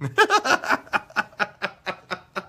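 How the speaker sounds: long cackling laugh; real laughing fit. Real, not acted. Laughs at own joke.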